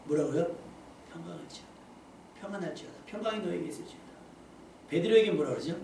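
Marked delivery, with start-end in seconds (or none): 1.1-4.0 much softer, gentle greeting of peace; soft, tender peace be with you; gentle blessing. Shown as a demonstration. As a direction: bless them softly, no reproach. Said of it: "아니에요".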